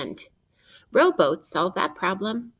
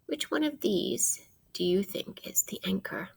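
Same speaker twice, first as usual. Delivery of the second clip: whisper; 6 dB quieter than usual. Real, not acted. Whispered question to children.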